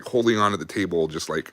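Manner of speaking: usual speaking voice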